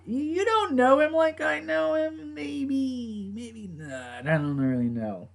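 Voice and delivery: high, slow, mocking sceptical voice that fades away; mock his unsure look, then trail off quietly; doubtful Jack. Imitating Jack doubting the pastor, high and wavering. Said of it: "he gives this look just like".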